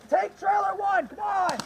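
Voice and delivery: loud, slow, high order; commanding shout. Real, not acted. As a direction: bark the order at the squad. Ordering team to retake.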